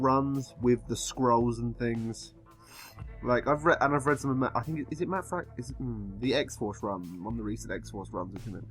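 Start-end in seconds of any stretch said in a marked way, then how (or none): none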